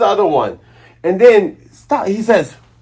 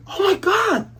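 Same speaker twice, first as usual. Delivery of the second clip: shocked Kaya; 7 dB quieter than usual. Retold or imitated. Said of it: "And Kaya's like".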